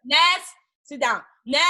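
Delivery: hamming up a client ordering her about; mock bossy client.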